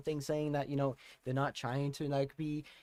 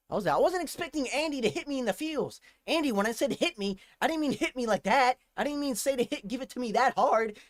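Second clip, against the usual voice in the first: higher, louder; mock-complaining, overwhelmed; 8 semitones higher, 5 dB louder than usual. Real, not acted. A fan teasing the singer, mock-complaining loudly. Teasing [name] after the song.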